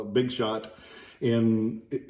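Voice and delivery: ordinary talk, nothing marked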